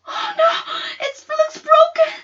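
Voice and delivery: high, loud, crying alarm; panicked cry. Acted. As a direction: wail it in fright over the wrist. Sister sees twisted wrist.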